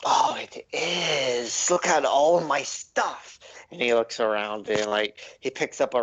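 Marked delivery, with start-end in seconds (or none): 0.0-3.4 high, slow, proud gnome voice; a gnome gloating over his clutter, drawn out. Acted. Gnome shows off his shop.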